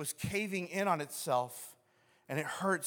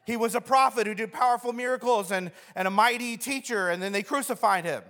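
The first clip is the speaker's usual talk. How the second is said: angry telling; 5 semitones higher, 6 dB louder than usual. Acted. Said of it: "they angrily tell Jesus".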